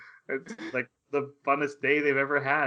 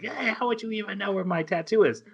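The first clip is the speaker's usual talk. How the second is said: mock freak-out; 6 semitones higher than usual. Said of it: "Yun Yun freaks out".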